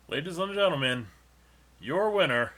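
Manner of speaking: booming boxing announcer; ring announcer. Acted. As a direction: announce the champion, big and grand. Announcing the winner.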